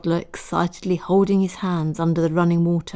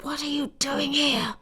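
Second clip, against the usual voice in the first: hissed; 9 semitones higher, 5 dB quieter than usual. A narrator's reading. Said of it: "she hissed".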